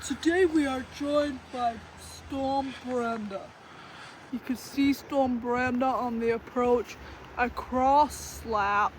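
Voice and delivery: mock weather reporter intro; slow, announcing like a TV weather girl; news presenter voice.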